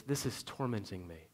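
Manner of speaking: a soft, quiet admission; quiet confession. Retold or imitated. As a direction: confess it softly to yourself. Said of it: "can have an honest moment and says".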